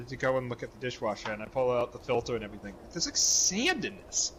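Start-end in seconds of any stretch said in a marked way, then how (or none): 2.9-4.3 high, baffled disbelief; rising astonishment at the sand; incredulous. Retold or imitated. Man finds sand in dishwasher, higher, incredulous.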